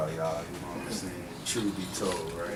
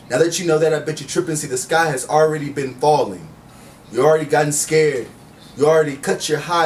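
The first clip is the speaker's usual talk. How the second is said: rhythmic spoken word delivery, each line landed; poetry slam recital; spoken word poem; 4 semitones higher, 3 dB louder than usual. Acted. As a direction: perform it like a slam poet on stage.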